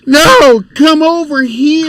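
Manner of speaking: put-on high, stretched-out kid's demand; imitating insistent child. Father retells child refusing his answer, imitated high insistent call. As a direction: insist like a child who wants him closer. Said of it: "He's like".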